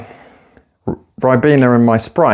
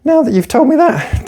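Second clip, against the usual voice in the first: much higher, sickly-sweet fake thank-you; mock gushing gratitude; 13 semitones higher than usual. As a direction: parody someone fishing for compliments in a high squeal. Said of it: "they're like".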